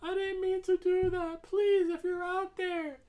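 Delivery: very high, put-on weepy wail; mock-sobbing, high-pitched apology; fake crying. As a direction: plead in a hammed-up tearful squeal. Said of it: "she's pretending to be sad. She's crying".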